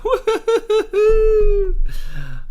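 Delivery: high, loud, hooting giggle; high laugh. Real, not acted. Actor delighted by his own discovery, high hooting laugh.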